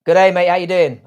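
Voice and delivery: loud, high Australian greeting; Aussie accent. Real, not acted. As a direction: belt out a hello in an Aussie accent. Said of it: "said that in an Aussie accent".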